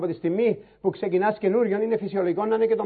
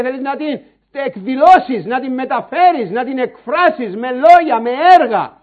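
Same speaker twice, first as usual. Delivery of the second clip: raised, urgent pulpit voice; preaching at full force; 5 semitones higher, 7 dB louder than usual. Acted. Preacher urging his flock to pray, fervent preaching. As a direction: drive it home to the congregation.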